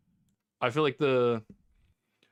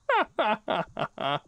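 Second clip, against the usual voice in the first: high laughter; 9 semitones higher than usual. Real, not acted. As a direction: burst out laughing. Laughs after shouting.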